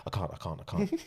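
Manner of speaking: very soft, imitating a scared friend; timid refusal. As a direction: back out meekly, almost under your breath. Acts out frightened friend refusing.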